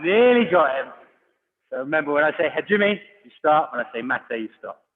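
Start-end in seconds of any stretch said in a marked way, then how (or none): 0.0-0.9 louder, higher playful tease; playful teasing. Real, not acted. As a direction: tease them for nearly missing. Coach teasing in drill.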